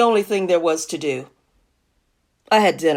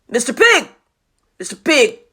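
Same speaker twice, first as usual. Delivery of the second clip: raised, loud call to a neighbor; 6 semitones higher, 4 dB louder than usual. Acted. Wolf calling the second pig, raised calling voice. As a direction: holler it towards the house. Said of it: "I called".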